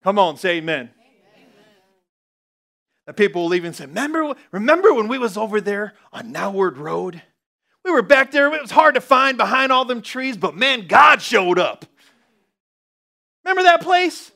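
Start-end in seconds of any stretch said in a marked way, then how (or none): 3.9-14.3 high, eager voice of a church member; excited reminiscing. Acted. Church member recalling where God showed up, excited. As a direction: relive the memory with excitement. Acting congregation remembering.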